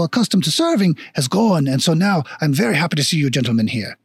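put-on stilt dwarf shopkeeper with a hammy French accent; lilting, mock-French dwarf voice; bad French accent. Worried dwarf shopkeeper tells visitors of lost trade, bad French accent. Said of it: "very shitty French accent".

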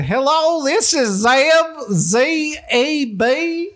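high, slow drawn-out impression; slow high mock voice. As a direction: stretch out the name, goofy caller. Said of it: "called my parents and said".

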